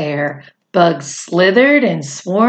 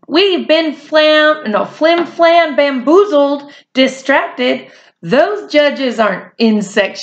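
mock alarm, raised voice; yelling; 6 semitones higher than usual. A narrator's reading. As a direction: shout a warning to everyone. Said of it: "She yelled".

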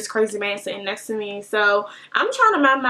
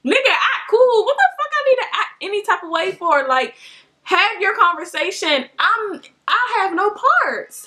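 high-pitched, incredulous retold comeback; dumbfounded retort; 7 semitones higher than usual. Train rider retelling her reaction to a stranger's request, incredulous. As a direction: protest it high, drawing out the last words. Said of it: "I was really dumbfounded".

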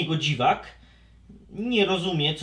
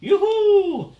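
very high, loud cheer; joyful whoop; 7 dB louder than usual. Acted. Szybcior cheering happily, stuck in the pot. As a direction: whoop with delight. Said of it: "radośnie krzyknął Szybcior".

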